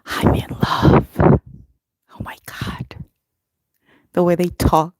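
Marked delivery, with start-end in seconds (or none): 0.1-2.9 swooning whisper; breathless whisper. Real, not acted. Telling Christine about the British guys in a smitten whisper.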